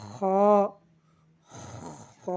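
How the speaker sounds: খ with long throat friction first; throat kho. Shown as a demonstration. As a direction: scrape খ from the end of the throat. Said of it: "হলকের শেষ হইতে উচ্চারণ করব". (হলকের শেষ থেকে খ)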